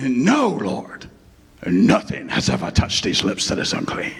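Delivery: low, breathy mock English accent. Peter refusing God in a low, hammed-up English accent. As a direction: play a pompous English actor. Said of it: "I don't do an English accent, or I try".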